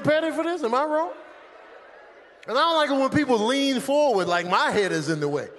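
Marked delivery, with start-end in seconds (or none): none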